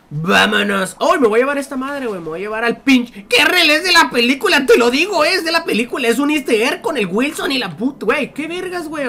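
loud, high excited yelling; excited shouting. Real, not acted. Gamer shouts to viewers about a movie easter egg, excited. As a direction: shout it out, thrilled with the find.

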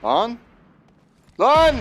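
shocked shout then a high laugh; startled yell. Real, not acted. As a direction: jump out of your skin.

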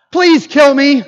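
loud, high mock outburst; exasperated plea. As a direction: melodramatic demand to be put out of misery. Acting out Jonah's despairing complaint.

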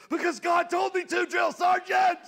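high, loud shouted answer; recruit shouting. Retold or imitated. Recruit shouting back at the drill sergeant, high loud.

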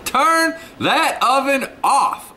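high, slow, drawn-out complaint about the heat; mock exasperation. To viewers, recalling the hot morning, mock exasperated wail. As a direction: stretch it out like you're melting. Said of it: "I was like".

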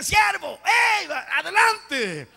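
loud, high welcoming holler; big hearty call, high-pitched; calling out a greeting. Retold or imitated. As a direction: shout them in heartily from across the room. Calls out welcoming arriving brothers.

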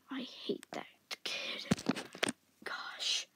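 muttered whispered aside. Acted. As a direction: hiss it under your breath. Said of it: "unvoiced whisper aside".

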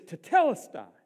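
loud proclaiming shout after a breath; forceful, declamatory cry; cried out from the cross. Retold or imitated. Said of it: "he takes his breath".

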